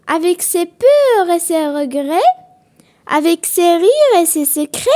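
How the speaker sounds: loud, measured verse reading with pauses between lines; poem recitation. Acted. Teacher reads a friendship poem to pupils, projected recitation. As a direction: recite it line by line, projecting clearly.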